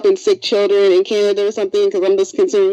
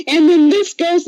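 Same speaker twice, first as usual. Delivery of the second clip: dramatic quote; 9 semitones higher, 7 dB louder, slower than usual. Retold or imitated. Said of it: "James said".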